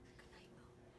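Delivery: a soft whispered good night. Acted. Saying good night to the moon in a whisper. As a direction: breathe it out in a whisper. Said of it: "whispered retake".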